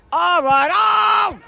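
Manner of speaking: high loud call building to the out; excited shout. Real, not acted. Commentator calling the ball out, rising loud shout. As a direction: yell it as the ball leaves.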